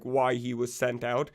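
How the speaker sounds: ordinary talk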